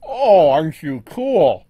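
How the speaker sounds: loud, slow admiring exclamation; delighted. Real, not acted. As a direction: gush over it, drawn out.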